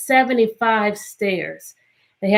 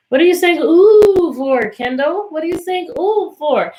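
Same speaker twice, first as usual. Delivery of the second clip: amused surprise; 6 semitones higher, 4 dB louder than usual. Real, not acted. Teasing a student's reaction.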